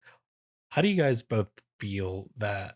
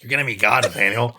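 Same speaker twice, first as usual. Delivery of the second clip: loud, badly faked impression of a friend; big, booming put-on character voice; Sadie; 2 semitones higher, 11 dB louder, faster than usual. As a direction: belt it out as a bad impression. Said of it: "like, a Sadie".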